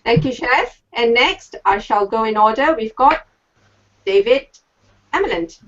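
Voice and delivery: usual speaking voice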